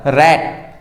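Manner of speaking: रैट said as one single syllable; one beat. Shown as a demonstration. Teacher showing there is only one place, रैट in one beat. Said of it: "रैट कहने में बस एक ही जगह".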